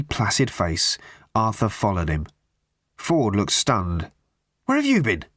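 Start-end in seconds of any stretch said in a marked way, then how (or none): 4.6-5.2 high-pitched indignant demand. A narrator's reading. Stunned Ford questions Arthur on his absence, demanding. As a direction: demand an explanation, voice jumping up high. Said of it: "he demanded".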